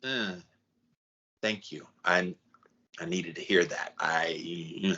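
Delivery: hesitant and moved, quietly; a touch of humble gratitude. Retold or imitated. A person taking tough love, softly halting thanks.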